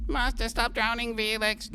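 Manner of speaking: tiny high-pitched plea; a small creature piping up from afar; slight little voice. Pretend. Game master voicing a little voice calling to master, high. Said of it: "you still hear the slight little".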